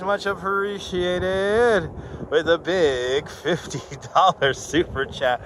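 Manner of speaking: slow, stretched-out catchphrase; announcer drawl. Acted. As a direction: stretch every word like a host. Drawn-out catchphrase.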